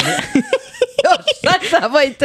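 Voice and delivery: slow, high, through laughter; laughing. Real, not acted. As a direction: giggle the words out. Laughing at start of show.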